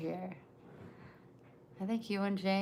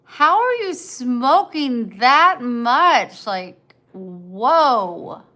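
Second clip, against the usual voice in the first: incredulous; 5 semitones higher, 12 dB louder, much slower than usual. Retold or imitated. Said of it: "I'm like".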